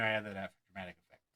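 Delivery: ordinary delivery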